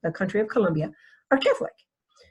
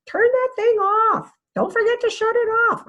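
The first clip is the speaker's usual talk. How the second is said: put-on parent telling child; 4 dB louder than usual. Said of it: "what would you say to them".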